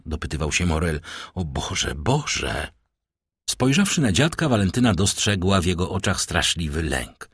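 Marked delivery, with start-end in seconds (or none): 1.3-2.7 low, softened lament; hushed anguish. A narrator's reading. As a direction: breathe it out low in dismay. Morel alarmed after her fall.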